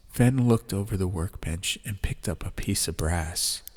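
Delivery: plain reading voice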